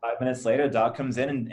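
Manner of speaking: ordinary talk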